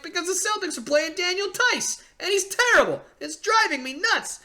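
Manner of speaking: high, exasperated rant at the Celtics; frustrated rant. Retold or imitated. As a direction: vent to dad, pitched high and fed up. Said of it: "I'm sorry. Okay, I'm gonna calm down".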